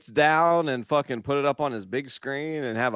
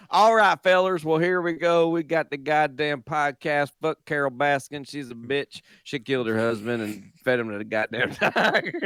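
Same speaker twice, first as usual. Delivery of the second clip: Tiger King voice. Acted. Podcast host lightly mocking Tiger King in a Southern accent. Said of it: "you went straight to Tiger King".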